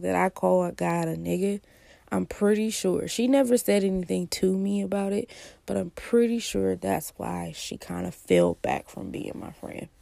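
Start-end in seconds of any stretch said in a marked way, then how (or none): none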